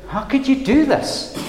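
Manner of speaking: high-pitched, incredulous reproach; acted disbelief, pitched well up; probing question. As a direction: put it to him as a pointed, high-pitched challenge. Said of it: "It's a very probing question".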